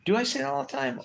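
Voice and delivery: high, amazed question; genuinely stunned, pitch shooting up; real shock at his own habit; surprised. Real, not acted. Realising he overuses words.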